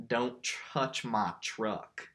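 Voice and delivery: slow, drawn-out country twang; mock country accent, stretched out; country singer accent. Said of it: "other type of country accent".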